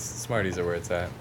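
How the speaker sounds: ordinary talk, nothing marked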